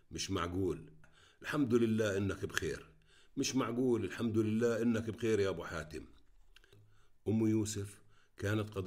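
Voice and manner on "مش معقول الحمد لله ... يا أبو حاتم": ordinary delivery, nothing marked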